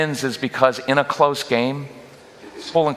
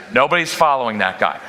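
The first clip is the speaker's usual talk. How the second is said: normal talking voice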